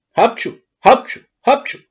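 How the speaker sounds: three loud high sneezes in a row. A narrator's reading. Moved puppeteer sneezing three times, loud and high. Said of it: "Și mai strănută de trei ori".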